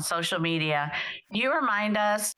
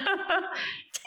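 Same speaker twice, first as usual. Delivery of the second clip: high-pitched burst of giggling; high giggle; 11 semitones higher than usual. Real, not acted. Laughs after cheering.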